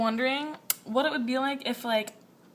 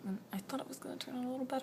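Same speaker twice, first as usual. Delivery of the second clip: whispering; 12 dB quieter, much faster than usual. Real, not acted. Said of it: "whispered fast aside".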